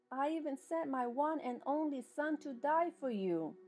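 normal talking voice

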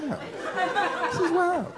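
very high, slow, thin "This is wild."; squeaky wild. Real, not acted. Marveling at the candy, squeaky and drawn out.

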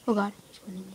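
ordinary talk

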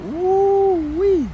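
high, loud drawn-out woo-wee; long high holler; excited woo-wee. Real, not acted. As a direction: holler in delight at the truck. Admiring a truck's front.

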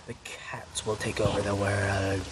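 low drawn-out villain boast; sinister mock villain. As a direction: intone it like a scheming overlord. Playing world-conquering cat villain.

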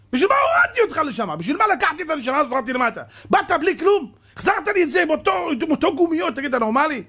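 high, loud crying shout; God shouting. Acted. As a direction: rage at the wasted soul. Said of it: "השם היה צועק עליו".

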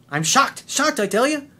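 hammed-up shock, pitch jumping up; mock shock. Coach mocking the coincidence with mock shock. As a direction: play the fake surprise big. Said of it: "Humphrey Bogart".

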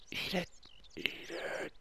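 hushed whispered coaxing. Real, not acted. Fisherman coaxing the fish to bite, hushed whisper.

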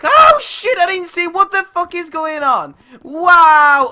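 loud, high startled shout. Real, not acted. Gamer caught off guard in a match, shouting. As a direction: yell in shock, starting high and drawing it out. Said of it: "I am shouting a little bit".

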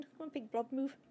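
ordinary talk

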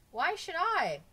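high, loud protest; exasperated retort. A narrator's reading. Bob defending going without socks, loud and exasperated. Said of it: "Bob shouted so loud it scared everybody".

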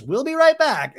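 high, loud TV throw to break; mock host sign-off. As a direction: imitate a big presenter announcement.

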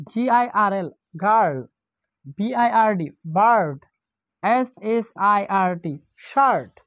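গার্ল, বার্ড, শার্ট each said with an er sound; spell then say ir words. Shown as a demonstration. Teacher drilling girl, bird and shirt for the students. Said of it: "আই শব্দটি আর মতো উচ্চারণ করবে".

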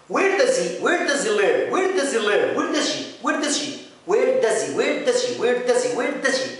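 "where does he" linked as "werdazi", repeated; linked-speech drill. Shown as a demonstration. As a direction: chain it smoothly, native-style. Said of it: "أنا بعيدها عشان تبان لك الفرق". (تكرار "where does he" موصولة)

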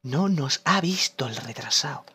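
quiet tense murmur; hushed. Real, not acted. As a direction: keep it low while hiding. Hiding from enemy.

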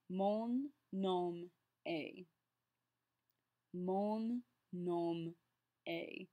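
mon nom est, slowly, est said like the letter A; slow teacher's demo, est sounding "ay"; pronunciation model. Shown as a demonstration. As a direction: separate each word of mon nom est, est as "ay". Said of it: "E S T is pronounced A".

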